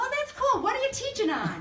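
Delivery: high, loud chirpy waitress voice; cheerful waitress asking a question, high and bright; perky waitress. Retold or imitated. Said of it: "She goes".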